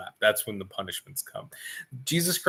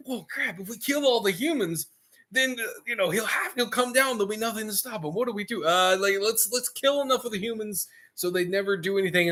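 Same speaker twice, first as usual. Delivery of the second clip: high-pitched muttering demon plotting; a demon's high voice talking it over with the others; scheming demon; 8 semitones higher than usual. Retold or imitated. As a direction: plot it like a nervous minion. Demons scheming among themselves.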